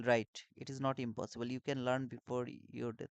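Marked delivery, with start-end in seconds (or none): none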